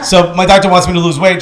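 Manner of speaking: usual speaking voice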